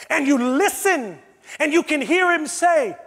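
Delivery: usual speaking voice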